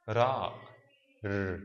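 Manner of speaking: "Ra", then "r" alone, tongue-tip R as in Spanish; Arabic R. Shown as a demonstration. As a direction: demonstrate the un-English Arabic "r". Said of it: "the Arabic R is not like the English one".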